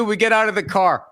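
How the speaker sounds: usual speaking voice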